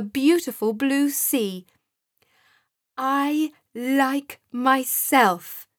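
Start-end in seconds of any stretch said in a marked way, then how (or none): 3.0-5.7 slow, louder, raised declaration; with more feeling. A narrator's reading. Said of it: "this time with more feeling".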